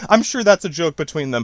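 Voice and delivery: usual speaking voice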